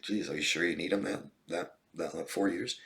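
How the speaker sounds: quiet, questioning aside; mock disbelief, softly. Therapist voicing a reaction to a viewer's comment, soft mock disbelief.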